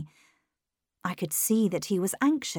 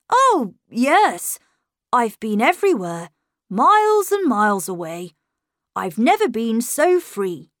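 put-on higher, louder courteous boy; polite boy; 4 semitones higher, 7 dB louder than usual. A narrator's reading. Miles replying to the governess, polite and lively. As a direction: answer politely but with lift and energy. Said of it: "he replied politely".

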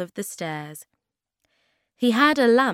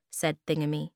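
plain reading voice

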